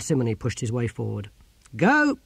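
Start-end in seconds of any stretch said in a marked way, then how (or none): 1.8-2.2 high, loud one-word order; sharp raised-pitch military order; sergeant's command. Acted. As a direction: shout the dismissal. Sergeant orders soldiers away.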